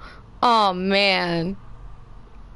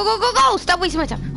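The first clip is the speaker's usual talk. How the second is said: ordinary talk, nothing marked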